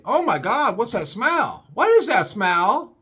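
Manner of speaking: high, disgusted female voice; disgusted woman. Retold or imitated. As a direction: play the woman sniffing the air, shocked. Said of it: "I hear her say".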